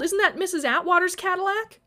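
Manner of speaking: high, loud calling question. A narrator's reading. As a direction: call out what you've spotted. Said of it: "Callie called".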